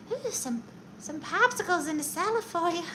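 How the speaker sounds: put-on high, quivering creepy old man; Mister Herbert. Creepy old guy offering popsicles, high quivering impression. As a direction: quaver it softly like a creepy cartoon old guy. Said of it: "Mister Herbert".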